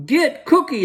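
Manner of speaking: very high, loud attack yell; shout. Acted. Bad boys leap at the cookie, yelling high and loud. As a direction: pounce and yell it. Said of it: "they shouted".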